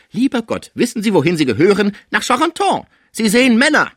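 high, loud, outraged telling-off; outraged scolding. A narrator's reading. Caretaker telling her patient he belongs in the madhouse, outraged scolding.

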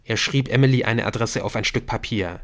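ordinary talk, nothing marked

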